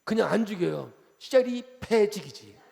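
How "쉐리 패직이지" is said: slow, laughing mock tough-guy dialect; rough Gyeongsang threat. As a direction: ham up a Changwon thug's threat, chuckling. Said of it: "우리 쪽이 세요".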